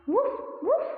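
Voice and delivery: high playful woof; dog bark. Shown as a demonstration. Teacher shows kids how a dog barks. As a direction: bark like a puppy. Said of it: "How does it sound?".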